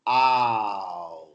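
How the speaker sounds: usual speaking voice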